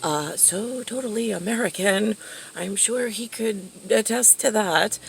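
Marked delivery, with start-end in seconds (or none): none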